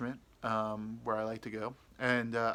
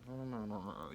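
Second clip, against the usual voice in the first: muttered refusal; 2 semitones lower, 9 dB quieter than usual. Retold or imitated. Privately refusing the crazy woman, dropping to a mutter. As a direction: quietly shut it down to yourself. Inner refusal after fake yes.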